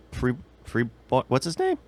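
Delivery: ordinary talk, nothing marked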